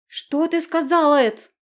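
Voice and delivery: ordinary delivery, nothing marked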